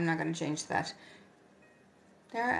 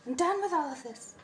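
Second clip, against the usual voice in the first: higher and louder, fed up; exasperated outburst; 8 semitones higher, 5 dB louder than usual. Shown as a demonstration. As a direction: throw your hands up, loud. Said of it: "I'm done with all this".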